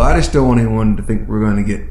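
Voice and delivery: ordinary talk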